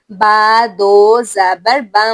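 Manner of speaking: بن held slowly through the nose; nasal ghunna. Shown as a demonstration. Teacher showing tanween with ghunna, slow and nasal. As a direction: hum the n of بن. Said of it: "ناک میں آواز کے چھپانے کو".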